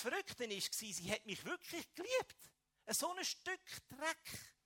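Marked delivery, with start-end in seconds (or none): 2.9-4.5 self-contempt. Real, not acted. Scorn at himself in testimony.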